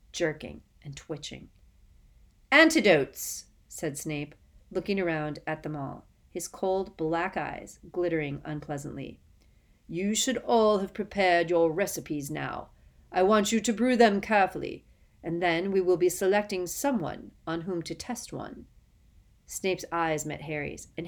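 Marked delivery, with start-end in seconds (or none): 2.5-3.4 cold, louder, higher opening word; stern Snape announcement. Acted. Snape begins the lesson. 9.9-18.7 louder, menacing classroom warning; cold stern teacher. Acted. Snape addresses the class.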